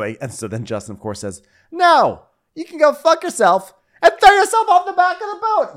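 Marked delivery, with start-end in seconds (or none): 1.7-5.7 very high, loud put-on yell; hammed-up tantrum at full volume; mock screaming refusal. Drunk guest refusing to go to bed, mock screaming.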